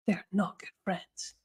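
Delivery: slow confiding whisper. Acted. Teacher whispering a verdict on the friends. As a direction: whisper it like a secret.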